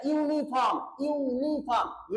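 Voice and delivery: यूनिफॉर्म stretched slowly, the yu sound clear at the start; slow pronunciation demo. Shown as a demonstration. Teacher to students, slowly sounding out यूनिफॉर्म. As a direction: draw out यूनिफॉर्म so the yu is heard. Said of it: "ये vocal sound नहीं है".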